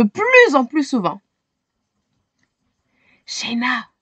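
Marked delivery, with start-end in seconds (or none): 3.3-3.9 softly murmured name; murmuring grandfather. A narrator's reading. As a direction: call her name under your breath. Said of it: "murmure le grand-père".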